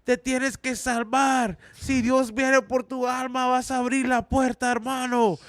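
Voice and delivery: high, slow, sustained mock preaching; street-corner preacher. Corner preacher warning sinners of salvation, imitated yelling. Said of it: "people in the corners that stand with a speaker".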